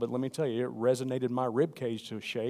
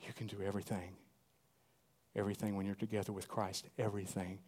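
lower, much softer; hushed promise; 4 semitones lower, 9 dB quieter than usual. Acted. Preacher voicing God gently to congregation, hushed. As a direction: drop to an intimate near-whisper.

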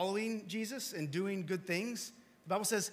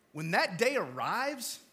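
ordinary talk

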